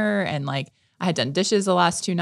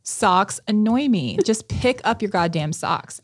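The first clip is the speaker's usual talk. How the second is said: slow, louder, pointed telling-off; exasperated retelling; 4 semitones higher, 4 dB louder, slower than usual. Retold or imitated. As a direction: re-enact scolding the family, drawing it out. Retold rant at family over socks.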